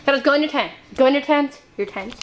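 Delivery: normal talking voice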